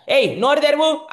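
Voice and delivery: loud, high command; mother loudly ordering Krishna to open his mouth; mother's order. Acted. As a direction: bark the order.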